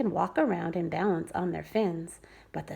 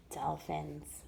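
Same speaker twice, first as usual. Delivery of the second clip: ordinary delivery, nothing marked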